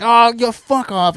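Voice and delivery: loud, high, slow mock brush-off; dismissive outburst. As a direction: imitate him giving up in disgust. Trucker gives up and leaves.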